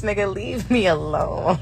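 usual speaking voice

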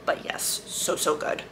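a quiet, breathy aside of pure delight; hushed whisper. Real, not acted. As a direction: whisper it softly to yourself. Aside about a heavy book.

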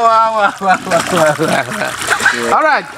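ordinary talk, nothing marked

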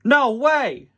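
loud, high cartoon exclamation; hammed-up storybook outburst; exclaiming Mater. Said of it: "said Mater".

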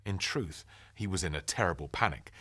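ordinary delivery

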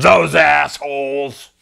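slow, scornful retold curse; drawn-out disgusted quote. Tramp to the narrator about others up the line, drawn-out disgust. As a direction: stretch the insult out slowly. Retelling a tramp's angry verdict.

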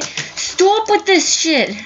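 high, slow, strained yell at the screen; furious shout. Real, not acted. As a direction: let the rage stretch out. Raging at the game.

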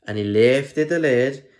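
plain reading voice

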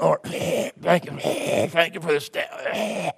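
drawn-out, choked sobbing prayer imitation; exaggerated sobby praying, word by word; mock tearful praying. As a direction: imitate a kid blubbering through a slow prayer. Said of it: "these kids were kinda going".

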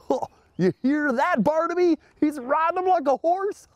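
high, giddy aside; amused excitement. Pretend. Host telling toy Barnaby about George riding, high giddy excitement.

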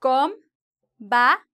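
"ex combatiente" very slow with long gaps between syllables; each syllable of "ex combatiente" standing alone. Shown as a demonstration. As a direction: count out "ex combatiente" one syllable at a time. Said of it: "so we have one syllable".

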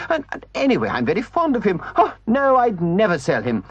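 splutter, then sneak in the real question; flustered Toad. A narrator's reading. Toad pretends he'd never sell the horse, flustered and high.

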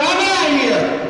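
high loud shout; shouted exit. Acted. Mayor shouting she is leaving, loud. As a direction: yell it as you storm off.

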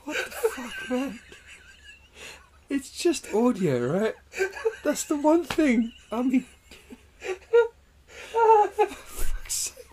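high-pitched, breaking up laughing; real uncontrollable laughing fit; helpless laughter. Real, not acted. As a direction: squeeze the words out through laughter. Helpless laughter after explosive hiccup.

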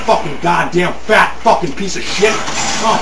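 ordinary talk, nothing marked

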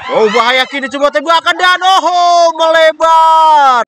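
high, loud and drawn-out miss call; excited shout. Real, not acted. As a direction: roar it as the shot flies wide. Shot goes just wide.

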